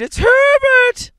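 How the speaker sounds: very high, drawn-out loud scream. A narrator's reading. Mother sure her son is knocking, long scream. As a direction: scream it slowly, stretching the name at full force. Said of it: "she screamed".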